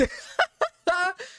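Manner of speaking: shrill burst of laughter; high-pitched laugh; high squealing laugh. Real, not acted. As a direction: cackle in disbelief. Laughing at the messages.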